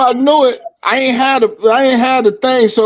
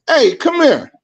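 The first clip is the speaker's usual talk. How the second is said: higher-pitched imitation of a summons; retold call-over; 8 semitones higher than usual. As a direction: call someone over, mimicking a stranger. Retold stranger calling him over.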